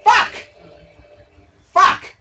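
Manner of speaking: sharp loud high curses; angry curse. Real, not acted. Gamer cursing at the screen after dying, sharp angry curses. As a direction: spit them out as you die.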